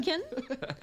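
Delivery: Kiwi accent, laughing. Shown as a demonstration. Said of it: "say the name like a Kiwi accent".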